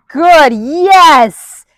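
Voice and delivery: high, loud burst of praise for the dog; excited praise. Real, not acted. Trainer cheering her dog for stepping up, high and loud.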